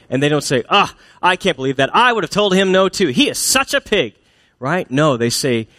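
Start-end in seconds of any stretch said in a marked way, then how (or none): 0.7-4.1 high, scandalized gossip; mock indignation. Friends in the break room siding with the wife, indignant. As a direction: pile on in hammed-up outrage.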